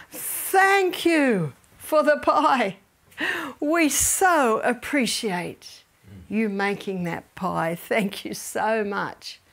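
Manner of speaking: slow, sincere appreciation to the stepdaughter; warm thanks. Retold or imitated. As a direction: savour each word of thanks. Her own appreciative words retold.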